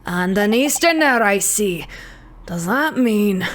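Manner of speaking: put-on Scottish-accented governor sizing someone up. Prison governor eyeing an Eastern visitor, Scottish accent. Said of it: "Do you know how to do a Scottish accent?".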